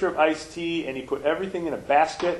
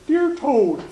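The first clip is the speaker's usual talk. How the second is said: much higher and louder, a light little frog voice; Frog's note voice; 11 semitones higher, 6 dB louder than usual. Acted. Minister reading Frog's note to the children, high frog voice. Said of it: "and the note said".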